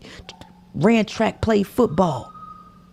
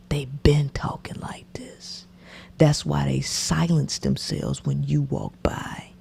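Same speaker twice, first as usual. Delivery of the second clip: whisper; 5 semitones lower, 7 dB quieter than usual. Real, not acted. Confiding to audience.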